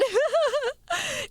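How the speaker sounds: a burst of high, squealing laughter. Real, not acted. Laughing at a silly chat message, high giggle.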